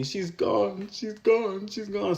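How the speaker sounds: high, mock tearful wail, not fully sobbing; imitating crying. Retelling Zach crying over the loss, high mock tearful wail. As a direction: retell a man's grief in a lifted, put-on crying voice. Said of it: "crying, saying".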